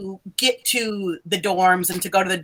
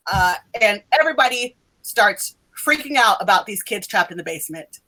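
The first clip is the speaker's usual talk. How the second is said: ordinary talk, nothing marked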